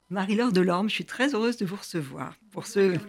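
ordinary delivery

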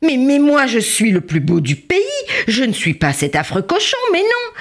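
high outraged mock wail; storybook howl of horror; horrified cry. A narrator's reading. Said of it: "Il hurlait".

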